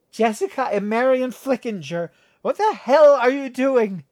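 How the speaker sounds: put-on loud slurred drunken shriek; drunk mother yelling. A narrator's reading. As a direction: yell it high and drunk with disgust. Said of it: "revulsion and alcohol slurring her words".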